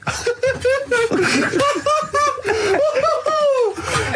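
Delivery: high, helpless laughter; real burst of laughter; long high laugh. Real, not acted. Laughing at Born Slippy.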